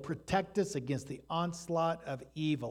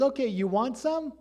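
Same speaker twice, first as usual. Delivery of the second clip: mildly mocking giant's dare, pitched up; a light playful taunt; lightly voiced giant sneer; Goliath taunting; 9 semitones higher, 4 dB louder than usual. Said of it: "Goliath says".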